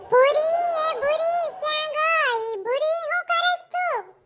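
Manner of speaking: high, slow drawn-out call from the doorway; friend calling out. Acted. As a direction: call her name high and long like a village friend. Friend Langu calling at door.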